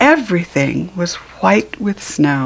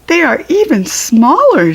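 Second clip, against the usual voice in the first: puzzled puppy; 7 semitones higher, 3 dB louder than usual. A narrator's reading. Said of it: "said one puppy".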